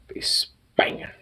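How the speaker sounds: slow, whispered aside; savoring whisper. Real, not acted. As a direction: let it trail off in a slow whisper. Finishing thought about the gun.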